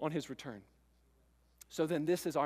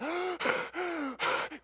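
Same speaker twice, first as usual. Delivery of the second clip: breathless gasp; 10 semitones higher, 4 dB quieter than usual. Acted. Messenger arriving out of breath, gasping. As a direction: gulp for breath. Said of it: "he's out of breath".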